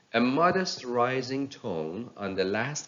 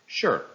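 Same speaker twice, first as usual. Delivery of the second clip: a certain, falling "Sure"; falling intonation; 3 semitones higher, 6 dB louder than usual. Shown as a demonstration. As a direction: quote "Sure" with a sharp fall. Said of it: "started with a falling intonation".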